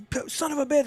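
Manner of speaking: high frustrated curse under the breath; retold curse. Client swearing on Skype after finding his money gone, frustrated high curse. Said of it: "I hear him say".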